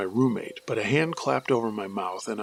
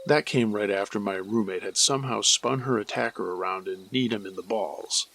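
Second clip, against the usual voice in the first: ordinary delivery, nothing marked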